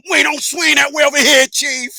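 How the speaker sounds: a shouted hammed-up catchphrase; quoting Hustle Man. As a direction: belt it like a street catchphrase. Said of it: "in the words of Hustle Man".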